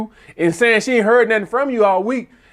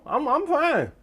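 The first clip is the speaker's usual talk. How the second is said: higher, softer stammered reassurance; mock reassurance; 8 semitones higher, 6 dB quieter, slower than usual. Man assuring her he can stay on the phone, high-pitched. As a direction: lightly squeak it, trying to keep her there.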